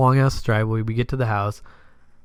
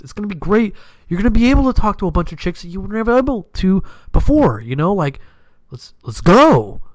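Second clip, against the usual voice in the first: hyped-up pitch; 7 semitones higher than usual. Retold or imitated. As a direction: pump up a shy friend to come out. Hyping friends to go out.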